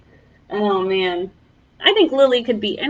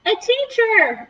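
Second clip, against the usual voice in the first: high, slow excited chorus; eager shout; 11 semitones higher, slower than usual. Acted. Whole class answers the teacher, high and eager. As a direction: call it out like eager kids. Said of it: "everyone responded".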